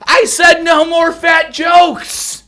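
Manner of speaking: high, loud goofy character voice; mock fat boy voice. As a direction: whine as the chocolate boy. Playing the chocolate boy himself.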